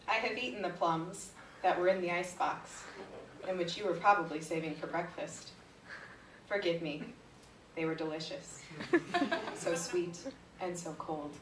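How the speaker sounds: low, measured poetry reading; understated, low-voiced comic verse reading; poem recitation. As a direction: recite it low and deliberately, pausing between phrases. Recites short poem to laughter.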